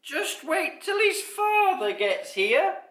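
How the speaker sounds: high, loud, drawn-out squeak; piercing little voice, stretching words; slowly, taken aback. A narrator's reading. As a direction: spell out the dawning realisation. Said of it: "said Basil slowly".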